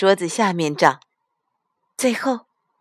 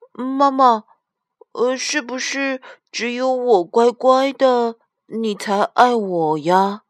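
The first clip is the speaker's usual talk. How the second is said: higher-pitched little daughter voice, hesitant; 小女儿阿丽; 6 semitones higher, slower than usual. A narrator's reading. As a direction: voice a child asking mum for reassurance, drawn out at the end. (小女儿试探妈妈的童声)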